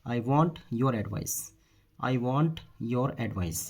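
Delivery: slow, word by word; measured dictation-style delivery; slow model sentence. Shown as a demonstration. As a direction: say it slowly for learners, spacing each word. Teacher models the English sentence.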